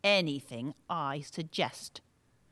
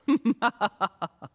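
gloating villain laugh; evil cackle; 3 semitones higher, 5 dB louder than usual. Acted. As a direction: cackle at your victory. Villain gloats over control.

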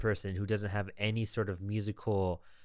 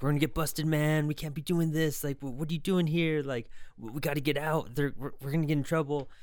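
higher-pitched mock panic; mild retold fretting; freaking out; 6 semitones higher than usual. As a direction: lightly imitate a nervous friend urging escape. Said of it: "his friend is freaking out".

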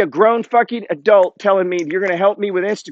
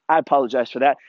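normal talking voice

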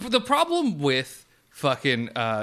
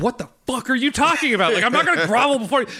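fast and louder, disbelieving; outraged; 8 semitones higher, 5 dB louder, much faster than usual. Retold or imitated. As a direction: snap back at her in outrage. Warrior outraged.

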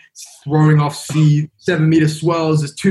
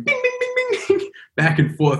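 high pinging mast noise; ping it like a snapping mast wire. Retold or imitated. Mimicking the loose mast going bing.

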